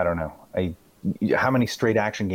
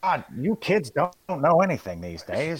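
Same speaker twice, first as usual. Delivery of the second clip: wise guy; 11 semitones higher than usual. Retold or imitated. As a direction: play the cranky old man lecturing kids. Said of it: "I'm turning into a wise guy here".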